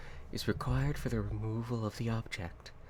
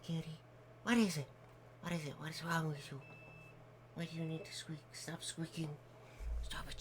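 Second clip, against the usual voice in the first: higher, soft pet talk; 3 semitones higher, 5 dB quieter than usual. Real, not acted. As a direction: coo at the kitty. Talking to the cat.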